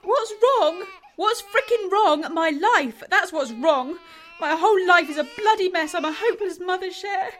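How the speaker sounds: distraught crying. Acted. Katie to Cher about her life, loud tearful outburst. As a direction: spill it out through sobs.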